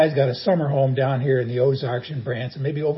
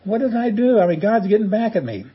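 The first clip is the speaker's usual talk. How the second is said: much higher, louder mock outrage; indignant dismay; 7 semitones higher, 3 dB louder than usual. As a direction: whine it as if wronged. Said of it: "And you think".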